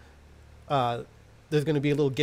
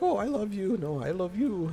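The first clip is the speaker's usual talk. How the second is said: higher-pitched, hammed-up sweet nothings; mock lovey-dovey couple; 6 semitones higher than usual. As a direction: parody two lovers trading "I love you". Mocking lovers' exchange of code words.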